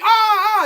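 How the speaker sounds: high loud startled yelps; panicked yelps. Retold or imitated. Rev as the tent falls on him, high yelps. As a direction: yelp twice as the tent collapses. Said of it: "he's like".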